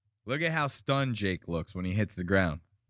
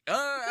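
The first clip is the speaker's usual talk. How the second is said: high, sudden mock cry of pain; mock pain groan; 3 dB louder than usual. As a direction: imitate a delayed yelp of hurt. Mimics delayed reaction to pain.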